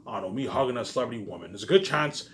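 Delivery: ordinary talk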